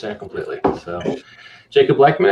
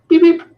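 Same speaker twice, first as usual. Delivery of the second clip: car fob beep; 8 dB louder than usual. Retold or imitated.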